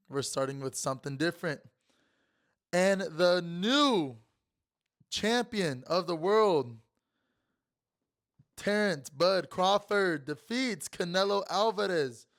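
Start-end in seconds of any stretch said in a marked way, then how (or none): 2.7-12.3 slow, loud ring announcer call; booming announcement of the new champion. Acted. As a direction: stretch it out like announcing a title fight.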